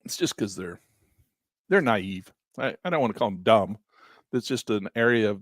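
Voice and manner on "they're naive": usual speaking voice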